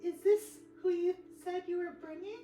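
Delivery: very high squeaky voice, laughing mid-line; cheerful slime creature. Acted. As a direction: squeak it out with bubbly delight. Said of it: "And she's like".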